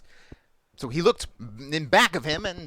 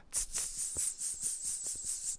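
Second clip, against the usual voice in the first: repeated voiceless tss; monkey "tss" chatter; 14 dB quieter, much slower than usual. Acted. Monkeys answering the angry peddler, hissed tss-tss. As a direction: imitate monkeys with a run of soft hisses. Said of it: "monkeys only shook both their hands".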